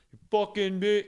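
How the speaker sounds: imitated angry shout; retold angry outburst. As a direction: curse him out as the furious stranger. Retold angry man cursing him.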